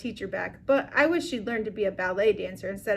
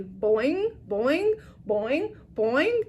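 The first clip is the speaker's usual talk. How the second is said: frog boing; 4 semitones higher, much slower than usual. Acted. Og the frog splashes.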